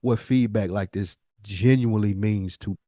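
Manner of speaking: ordinary talk, nothing marked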